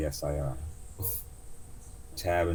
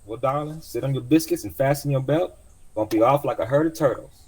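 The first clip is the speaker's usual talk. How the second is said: much higher, bossy auntie; old cab driver; 9 semitones higher, 6 dB louder than usual. Acted. Driver warning her passenger before takeoff, high brisk voice. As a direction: brace him like a cheerful old driver.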